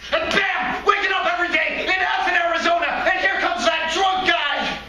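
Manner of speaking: sudden high-pitched yell; startled shouting. Acted. Monkey waking in Arizona to the drunk guy, startled shout. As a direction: burst out of the dream at full shout. Rude awakening from the dream.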